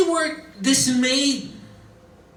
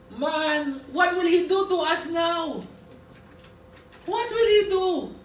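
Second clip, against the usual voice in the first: worried brothers; 5 semitones higher than usual. Acted. Brothers fearing Joseph.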